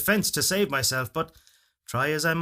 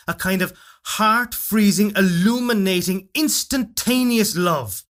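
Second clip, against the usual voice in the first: rising passion; 6 semitones higher, 7 dB louder than usual. A narrator's reading. Building passion about love.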